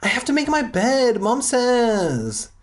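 high, whiny little brother; whining kid. Retold or imitated. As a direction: whine it like a spoiled kid. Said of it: "Simon whined".

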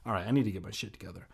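rushed low aside to self; fast mutter. Real, not acted. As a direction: mutter it fast under your breath.

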